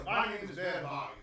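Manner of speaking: put-on courtly southern-accented host. Gentleman apologizes to his visitors, southern accent. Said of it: "Why does he got a southern accent?".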